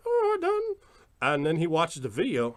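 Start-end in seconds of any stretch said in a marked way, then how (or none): 0.1-0.8 high put-on mom voice; mother. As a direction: mimic a mom answering her kid. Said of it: "she's like".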